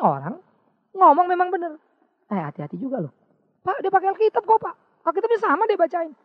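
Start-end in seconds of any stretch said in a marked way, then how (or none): 3.6-6.1 higher, louder insistent churchgoer; mimicked churchgoer. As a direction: protest that he uses the Bible too. Congregant defends a false preacher.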